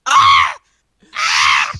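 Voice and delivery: two short mock yells of a random guy; mock screaming. Mimicking game sound effects, short mock yells. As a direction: yell like a stranger screaming in the game. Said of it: "some random guy screaming".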